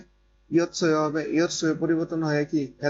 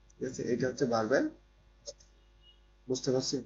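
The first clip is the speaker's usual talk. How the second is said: ordinary talk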